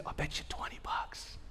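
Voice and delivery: hushed whispered wager; whispered bet. Retold or imitated. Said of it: "And I always whisper to him".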